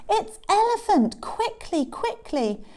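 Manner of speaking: high, urgent call; alarmed cry. Acted. Animals rushing to help Elephant, alarmed cry. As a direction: raise the alarm, pitched high.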